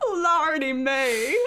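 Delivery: drawn-out mock Southern exclamation through laughter; hammed-up Southern drawl, laughing; Southern accent. Leaning in at Times Square, laughing Southern drawl. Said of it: "we were really leaning in".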